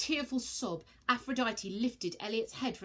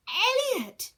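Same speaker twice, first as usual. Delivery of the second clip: much higher, loud scream of his name; joyful scream; 9 dB louder than usual. Acted. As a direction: shriek it in sheer relief. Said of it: "screamed Virgo".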